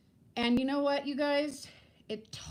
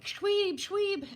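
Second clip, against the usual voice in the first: friends calling out; 10 semitones higher, 4 dB louder than usual. Retold or imitated. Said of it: "all his friends were like".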